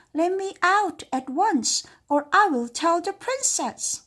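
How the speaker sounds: high, loud indignant cook; trapped cook's demand. Acted. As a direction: demand it shrill from inside the belly. Cook trapped in dragon's tummy.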